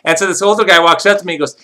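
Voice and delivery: ordinary delivery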